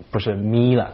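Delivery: ordinary talk, nothing marked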